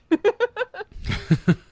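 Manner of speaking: shrieking high giggle; high squealing laugh; high burst of laughter. Real, not acted. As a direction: cackle at the joke. Laughing at the size.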